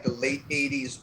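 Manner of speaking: ordinary talk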